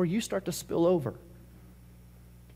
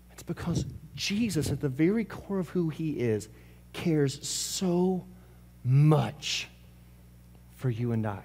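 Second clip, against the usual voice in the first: softer, lower, tender; tender hush; 3 semitones lower, 7 dB quieter, slower than usual. Acted. Preacher telling the congregation how much Jesus cares, softly. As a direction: bring it down close and gentle.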